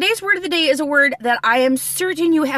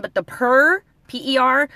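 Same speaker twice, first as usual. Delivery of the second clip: ordinary talk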